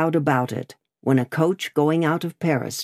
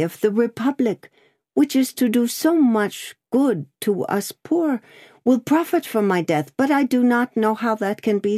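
higher, gentle seamstress voice; a frightened girl trying to be brave; frail pleading girl; 6 semitones higher than usual. Acted. Seamstress faces death.

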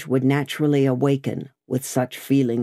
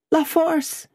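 high-pitched, distressed outburst; anguished answer; 11 semitones higher than usual. A narrator's reading. Lucy naming her husband's prison to Lorry, anguished. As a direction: blurt the name out high, in distress.

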